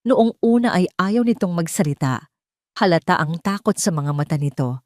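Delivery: plain reading voice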